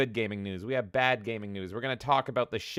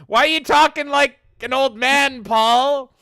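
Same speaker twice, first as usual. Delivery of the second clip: high, loud playful outrage; loud mock scolding; 12 semitones higher, 11 dB louder than usual. Host teasing a viewer for nostalgia, mock-outraged yell. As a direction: yell at him in pretend indignation.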